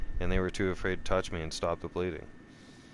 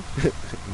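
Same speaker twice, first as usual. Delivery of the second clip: short unvoiced gloating laugh; a quick airy laugh at her bad luck; a breath of a gloating laugh; breathy laugh; 6 semitones higher than usual. Real, not acted. Laughs at his own karma line.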